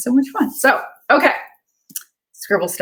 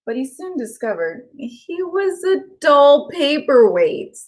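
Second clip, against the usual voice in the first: lilting storybook read-aloud; gentle sing-song story narration for kids; reading to children; 5 semitones higher, slower than usual. Acted. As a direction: read it like a bedtime picture book. Reads picture book aloud.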